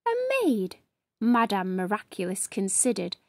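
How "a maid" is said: high, softer disbelieving rise; surprised, weighing up the idea; surprised question. Acted. Madame Miraculous surprised by Tiri's maid idea, rising high.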